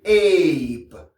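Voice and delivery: "ape" with long AY and sounded P; English AI plus P. Shown as a demonstration. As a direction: stretch "ape", then pop the P. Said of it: "Si on ajoute P". (« ape » allongé, P final prononcé)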